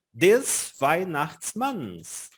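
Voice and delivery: Des Weihnachtsmanns drawn out very slowly; slow careful pronunciation. Shown as a demonstration. Teacher modeling the genitive for students, very slow. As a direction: spell it out in sound, very slowly. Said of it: "Pode pronunciar pausadamente".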